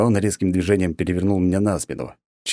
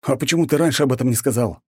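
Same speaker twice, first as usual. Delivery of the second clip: higher, fast indignant question; 7 semitones higher, faster than usual. A narrator's reading. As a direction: demand it, outraged. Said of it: "возмутился я". (возмущённо, быстро)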